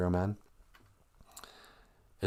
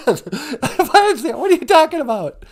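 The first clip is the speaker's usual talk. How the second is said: high questioning voice; 5 dB louder than usual. Acted. Second voice asks, high.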